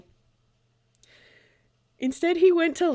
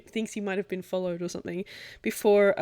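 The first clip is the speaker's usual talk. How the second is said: normal talking voice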